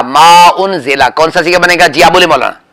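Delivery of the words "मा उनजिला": slow, drawn-out reading of मा उनजिला; slow recitation. Shown as a demonstration. Teacher reading the word slowly for students, drawn out. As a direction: spell out मा उनजिला slowly, each syllable clear. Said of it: "फिर दोबारा बोले".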